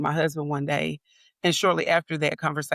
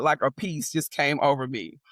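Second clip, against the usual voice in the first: ordinary talk, nothing marked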